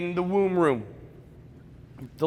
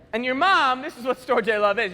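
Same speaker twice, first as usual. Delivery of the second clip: ordinary talk, nothing marked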